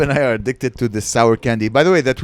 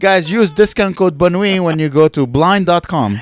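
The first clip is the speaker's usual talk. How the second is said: ad read; 5 semitones higher, 8 dB louder than usual. Acted. Plugs a sponsor code.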